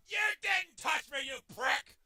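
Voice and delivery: high, loud angry protest; real gamer rage; outraged shout. Real, not acted. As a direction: yell the accusation at the enemy. Outraged at enemy.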